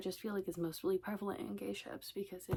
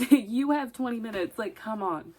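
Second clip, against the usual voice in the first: mock impatient demand; 3 semitones higher, 8 dB louder than usual. Pushing friend to catch up.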